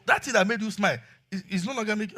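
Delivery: usual speaking voice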